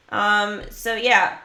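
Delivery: ordinary delivery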